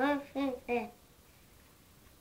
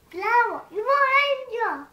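excited high exclaim; 11 semitones higher, 12 dB louder than usual. Acted. The hen's pleading line.

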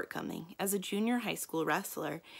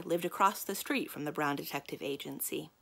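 usual speaking voice

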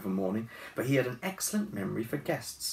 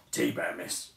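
gruff; 4 semitones lower than usual. A narrator's reading. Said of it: "he said gruffly".

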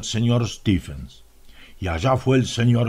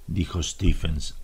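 usual speaking voice

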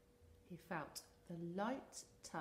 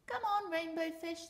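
calling out; 10 semitones higher, 9 dB louder than usual. Acted. Fish friends calling out.

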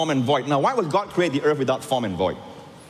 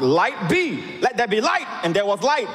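proclaiming God's first words; 7 semitones higher than usual. Retold or imitated. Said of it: "I know it by heart".

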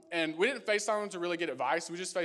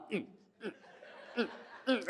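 short high muffled grunts; muffled protest; 5 semitones higher, 5 dB quieter than usual. Acted. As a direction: grunt through a covered mouth. Acts out hand over mouth.

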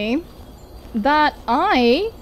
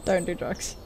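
whispered, much softer aside; whispered warning; 9 semitones lower, 12 dB quieter than usual. Acted. Gamer whispering a joke anti-drug warning. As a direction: breathe it like a secret PSA. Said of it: "PSA aside, whispered".

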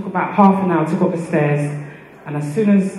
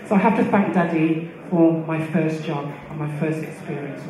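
ordinary talk, nothing marked

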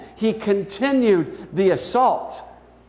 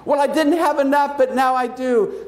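put-on child voice; 6 semitones higher than usual. A narrator's reading.

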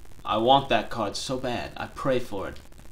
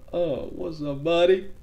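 higher, drawn-out cooing at the cat; pet talk; 7 semitones higher, 5 dB louder, slower than usual. Real, not acted. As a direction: melt over the cute kitty.

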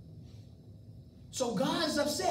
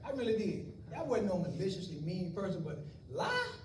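normal talking voice

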